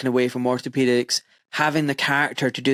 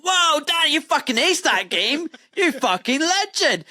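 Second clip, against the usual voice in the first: mock crowd cheer; 13 semitones higher than usual. Afterlife crowd cheering Dan.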